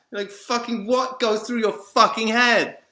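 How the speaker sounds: loud, high, outraged demand; angry shout. Real, not acted. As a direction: yell it as an accusation. Shouts at people who judge him.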